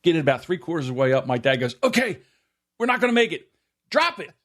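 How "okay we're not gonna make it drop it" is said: high, strained calls of a retold father; dad calling out. Father abandoning the lift with his boys, high urgent call. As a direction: call the order out in a raised pitch.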